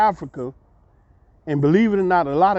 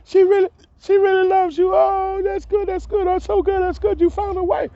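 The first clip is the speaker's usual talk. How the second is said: excited scream. Retold or imitated. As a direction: squeal with joy. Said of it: "Vida just screamed".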